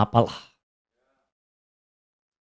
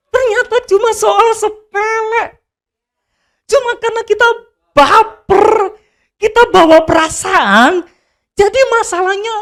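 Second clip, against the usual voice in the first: high, whiny, dragged-out mock voice; 12 semitones higher than usual. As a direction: mimic a self-pitying baper person. (suara cengeng mengejek)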